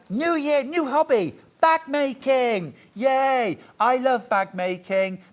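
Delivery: high, loud hype; excited announcement. Real, not acted. Host trails tomorrow's bag making menu, excited and high. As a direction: cheer each item like a big reveal.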